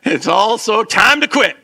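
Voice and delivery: loud, high, drawn-out proclamation; mock-triumphant shout; rousing joke repeat. Real, not acted. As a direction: call it out slowly like a rallying cry. Joking repeat as rallying cry.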